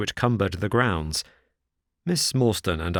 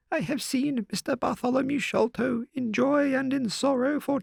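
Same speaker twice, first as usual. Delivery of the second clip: put-on much higher, worried old servant's voice; anxious old housekeeper. A narrator's reading. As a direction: urge them upstairs in distress.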